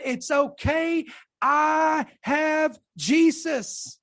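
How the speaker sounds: very slow, drawn-out declaration; slow assurance. Retold or imitated. Preacher quoting his dying mother's faith, slow and drawn-out. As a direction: stretch each word out with settled conviction.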